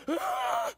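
high-pitched winded cry; out-of-shape gasp. Retold or imitated. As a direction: let out a high, breathless yelp. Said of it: "totally out of shape, running".

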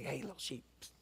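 hushed, tempting whisper; a sly whisper from the enemy; enticing whisper. Pretend. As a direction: lure the sheep close, voiceless. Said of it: "the enemy will whisper in our ear".

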